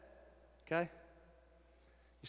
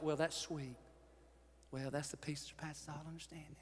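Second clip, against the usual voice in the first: churchy tone; 5 semitones lower, 13 dB quieter than usual. Retold or imitated. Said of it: "churchy kind of peace".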